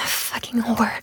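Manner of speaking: ordinary talk, nothing marked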